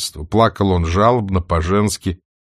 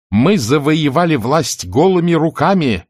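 raised rally speech voice; oratorical; 8 semitones higher, 5 dB louder than usual. Acted. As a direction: declaim it to the hall. Orator speaking in assembly.

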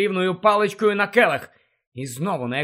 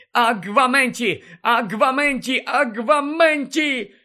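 frantic spell casting; 7 semitones higher than usual. Acted. Harry casting spell repeatedly.

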